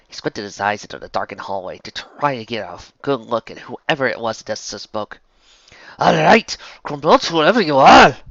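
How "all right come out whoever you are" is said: high, loud Scots demand; challenging shout. Acted. Scottish villain to an unseen voice in the darkened hallway, challenging shout. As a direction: call out at the hidden intruder in Scots.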